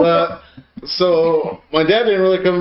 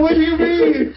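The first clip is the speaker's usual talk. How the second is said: higher, giggling question; laughing; 11 semitones higher than usual. Retold or imitated. Telling his dad the towel story, laughing. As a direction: laugh while asking back. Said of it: "And I started laughing".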